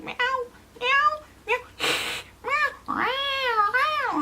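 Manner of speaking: very high yowling cat impression; high-pitched mock meows, falling off; imitated cat meows. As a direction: mimic an agitated cat meowing. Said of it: "And he'll be like".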